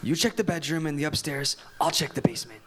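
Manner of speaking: hushed and low, sneaking through a house; soft, tense whisper. Acted. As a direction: keep it down so nobody hears. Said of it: "They whisper as they creep along".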